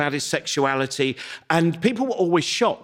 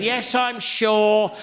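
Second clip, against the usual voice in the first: voicing Lizzie; 5 semitones higher, much slower than usual. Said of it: "She went".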